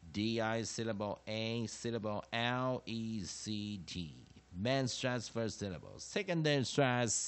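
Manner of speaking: measured classroom recitation in separate phrases; chanted phonics drill, firm on each term; syllable practice drill. Shown as a demonstration. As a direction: recite each rule like a drill for students to repeat. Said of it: "我们做最后一次练习音节的方式".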